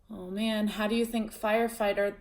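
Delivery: ordinary delivery, nothing marked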